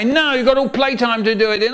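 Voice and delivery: high fast brisk refusal; teacher briskly refusing a pupil. Retold or imitated. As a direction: brush the child off.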